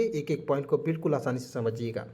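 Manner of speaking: ordinary talk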